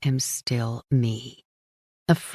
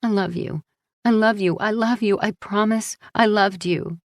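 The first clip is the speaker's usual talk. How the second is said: desperate pleading; 6 semitones higher than usual. Acted. Pleading over dying Paul.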